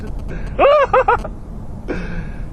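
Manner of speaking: high, loud burst of laughter; exhilarated laugh. Real, not acted. Thrill of fast driving.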